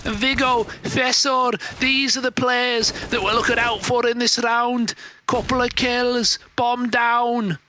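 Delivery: mock English caster voice; put-on English accent. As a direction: call the match in a hammed-up English accent. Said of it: "three different English accents".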